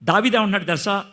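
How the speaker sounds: usual speaking voice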